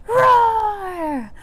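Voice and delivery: high, loud pretend roar; dragon roar. Librarian playing dragon for children, roaring loudly. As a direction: roar the word out long like a dragon. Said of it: "so roar really loud".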